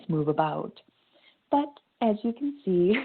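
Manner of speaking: ordinary delivery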